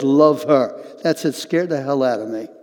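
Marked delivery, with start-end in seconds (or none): 0.0-0.8 loud, forceful command; strong, emphatic insistence. Retold or imitated. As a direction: lay down the order firmly and loudly. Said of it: "then the voice came strong".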